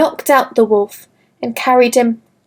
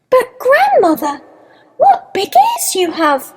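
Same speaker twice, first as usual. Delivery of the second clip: put-on high-pitched child voice, amazed; wondering little girl; 12 semitones higher than usual. A narrator's reading. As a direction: pitch it up like a wide-eyed girl. Little girl marvels at the wolf.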